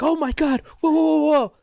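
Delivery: loud, high amazed whoas; excited shouting. Retold or imitated. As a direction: cry out in amazement. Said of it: "Everybody was like".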